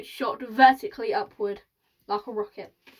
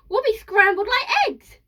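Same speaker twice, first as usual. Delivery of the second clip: very high, loud wail of alarm; squawking, horrified granny; panicking old woman; 11 semitones higher, 8 dB louder than usual. A narrator's reading. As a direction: shriek it in comic dread. Grandmother panics about crashing.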